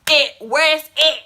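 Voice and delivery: loud, slow confronting demand; angry demand. Retold or imitated. Confronting the boy about her things, loud demand. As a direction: demand your stuff back, loud and slow.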